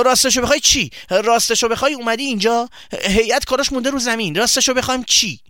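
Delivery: high, loud, indignant challenge; angry interruption. Acted. Boy cuts off his friend's excuse, angrily. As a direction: throw his words back at him, outraged.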